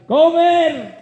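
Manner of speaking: desperate husband shouting for his wife; calling out. Acted. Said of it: "Empezó a gritar".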